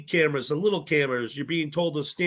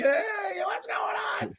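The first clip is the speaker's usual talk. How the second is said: high-pitched mock cheer back; thin, high imitation of a shouted reply; mimicked group greeting; 4 dB quieter, slower than usual. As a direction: imitate a crowd's excited hello, way up high. Mimics everybody greeting him back.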